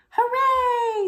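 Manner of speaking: very high, loud cheer; children cheering. Acted. Children cheer for the lion's return, loud high cheer. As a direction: cheer like delighted kids. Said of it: "said the children".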